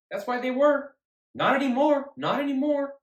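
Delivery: loud, high, worked-up rant; heated outburst. Real, not acted. Vlogger ranting to viewers about Florida State losing, loud and high. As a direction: let it build into an indignant shout. Heated recap of failed pick.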